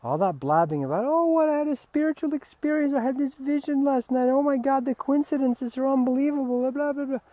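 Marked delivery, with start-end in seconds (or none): 1.1-7.2 high mock gushing voice; mocking gushing seeker. Mocking those who blab about visions, high and gushing. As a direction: parody a breathless spiritual show-off.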